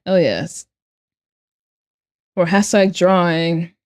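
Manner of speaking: ordinary delivery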